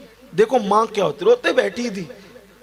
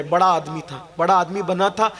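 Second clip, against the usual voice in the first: normal talking voice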